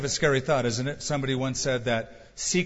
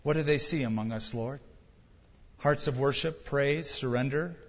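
closing prayer; 3 semitones lower than usual. Real, not acted.

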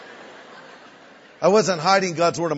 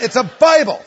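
higher, loud fed-up shout; exasperated shout; 6 semitones higher, 8 dB louder than usual. Retold or imitated. Greg finally shouting the answer at his friends, loud and exasperated. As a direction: blurt it out loud at last.

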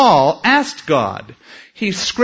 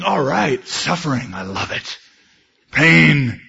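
mock relish of pain. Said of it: "he would be a little flipped".

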